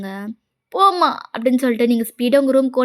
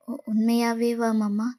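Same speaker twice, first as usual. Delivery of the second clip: soft, slow, faltering question; hushed disbelief; 6 dB quieter, slower than usual. Acted. Girl checking he truly agreed to marry her, hushed disbelief. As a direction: ask timidly, hardly daring to believe it.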